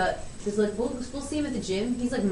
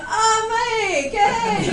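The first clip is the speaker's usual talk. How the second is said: high, loud hammed-up hello; mock excited greeting; 13 semitones higher, 5 dB louder, much slower than usual. Daughter imitating shop staff greeting Dad, over-excited. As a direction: gush like greeting an old friend.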